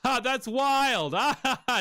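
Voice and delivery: high, slow fake laugh; mock laughing reaction. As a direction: ham up a fake amazed laugh. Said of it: "that other person just going".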